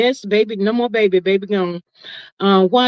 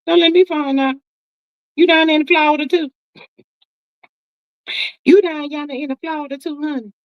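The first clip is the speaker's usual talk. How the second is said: teasing disbelief; 7 semitones higher than usual. Real, not acted. Playful teasing of a viewer.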